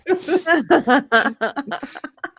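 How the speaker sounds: high burst of laughter. Real, not acted. Laughing hard at the marketing joke. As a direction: crack up at the joke.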